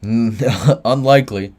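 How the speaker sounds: a slow amused laugh into the word; chuckle, then drawl it out doubtfully. Real, not acted. Laughing off a chat question.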